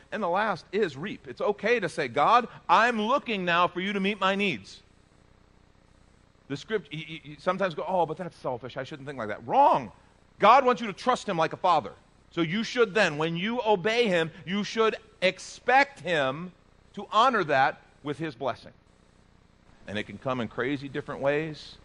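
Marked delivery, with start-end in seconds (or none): 0.1-9.5 high, loud preaching climax; preaching at full force. Acted. Pastor telling the church to expect blessing, loud preaching. 9.5-9.9 high, loud emphatic rebuke; loud wrong. Acted. Pastor rejecting the congregation's doubt, one loud emphatic word. 10.4-18.7 high, loud preaching climax; preaching at full force. Acted. Pastor telling the church to expect blessing, loud preaching.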